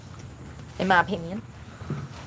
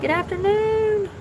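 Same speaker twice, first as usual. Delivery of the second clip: sing-song greeting; 12 semitones higher, slower than usual. Real, not acted. Greeting someone arriving.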